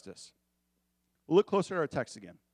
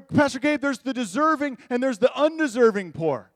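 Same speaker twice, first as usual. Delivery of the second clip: loud, higher, indignant objector; defensive churchgoer; 8 semitones higher, 7 dB louder than usual. Retold or imitated. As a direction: push back on the pastor, louder and higher. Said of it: "Or, or".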